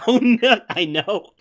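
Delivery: loud, high laughing outburst; laughing dismay. Real, not acted. Streamer recalls rambling in dialogue, laughing dismay. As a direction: laugh through the words.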